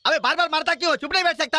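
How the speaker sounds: high-pitched, aggrieved scolding; exasperated protest. Acted. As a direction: tell him off, fed up with being hit. Hit again, protests.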